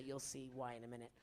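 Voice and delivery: very low, soft dad voice; deep, hushed cartoon-dad reply; deep father voice. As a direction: answer low and quiet, in character. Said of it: "Why are you using that voice?".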